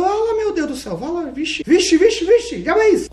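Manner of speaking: very high startled gasp; shocked. Acted. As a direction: freak out at two babies. Midwife shocked by twins.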